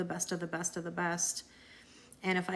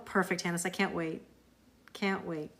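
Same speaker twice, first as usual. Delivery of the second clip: ordinary talk, nothing marked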